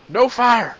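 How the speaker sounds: high, loud shout from back at the bar; angry call from far off; distant yell. Acted. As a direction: bellow a warning across the room. Bar patron yelling from afar.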